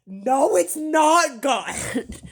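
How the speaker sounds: slow, loud, high No; loud protest. Real, not acted. Arguing back at the happy eaters, loud and slow. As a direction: drag out the refusal in disgust. Rebutting the imitated diners.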